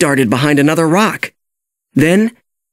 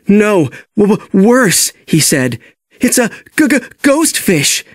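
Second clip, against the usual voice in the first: frightened stutter, voice pitched up; scared stammer; 6 semitones higher than usual. A narrator's reading. Sheldon stammers to Nemo, terrified of the ghost fish. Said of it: "His tail was quivering in fright".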